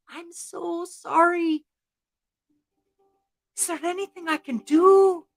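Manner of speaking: high-pitched, earnest begging; pleading Sean O'Sullivan. Acted. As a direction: plead for a way to make amends. Sean begs forgiveness.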